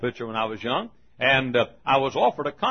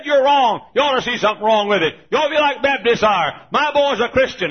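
high, loud preaching tirade in imitation; mock screamed scolding; hollering mother; 10 semitones higher, 8 dB louder than usual. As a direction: berate him as the outraged mother. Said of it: "she hollered and screamed at him".

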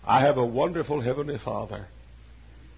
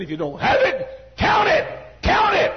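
much higher, much louder ringing cry; Paul's shout; 9 dB louder than usual. Acted. Preacher voicing Paul commanding the church, shouted. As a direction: hurl the command to the congregation.

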